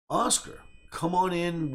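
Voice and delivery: retold enthusiastic invitation; eager welcome. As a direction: wave them in, acting out an eager host.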